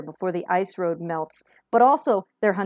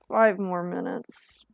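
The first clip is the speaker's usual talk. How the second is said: sleepy whisper; 4 dB quieter, much slower than usual. Acted. Woken up in the morning.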